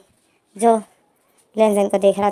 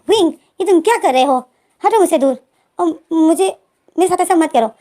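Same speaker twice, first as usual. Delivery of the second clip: raised, louder objection; startled protest; 5 semitones higher, 4 dB louder than usual. Retold or imitated. Lenzen pushing Wing off after a sudden kiss, startled protest. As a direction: push away with an urgent voice, lightly acted.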